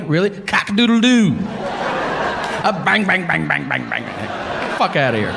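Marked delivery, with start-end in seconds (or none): 0.3-1.3 loud, high mock rooster call; rooster crow. Comedian mocking a would-be cowboy, loud rooster crow. 1.3-4.8 rattled-off mock pistol shots, falling away; imitated gunfire. Comedian playing cowboy with pistols, mock gunfire.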